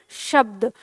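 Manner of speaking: शब्द with a clear opening sh; tongue under the palate for the श of शब्द; palatal sh. Shown as a demonstration. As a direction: demonstrate शब्द as "shabd". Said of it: "जीभ है वो तालु के नीचे".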